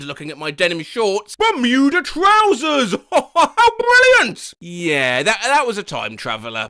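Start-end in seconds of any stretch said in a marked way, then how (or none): 1.4-4.5 high, loud, slow ecstatic exclamation with a laugh; delighted scream. Retold or imitated. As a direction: shriek with delight like a time traveller.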